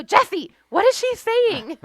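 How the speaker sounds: put-on high, loud, baffled cry to the older sister; a mother at the end of her rope, calling out for help; exasperated mom. Mom in the van begging Jessie to translate, high and loud. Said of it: "And she'd go".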